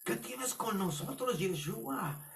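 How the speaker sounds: high-pitched acted cry of an unclean spirit; demon crying out. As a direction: protest like a demon confronted. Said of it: "por qué gritó de esta manera". (grito agudo de espíritu inmundo)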